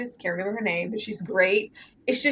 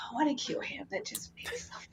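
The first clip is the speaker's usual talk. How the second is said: whisper; 7 semitones higher, 13 dB quieter than usual. Real, not acted. Ranting about the film.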